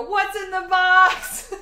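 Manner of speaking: high, loud, slow movie yell; screaming quote. Retold or imitated. Wanting to scream at the drive-thru girl, loud and high. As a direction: scream the line like Brad Pitt. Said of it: "my insides are screaming 'cause I wanna go".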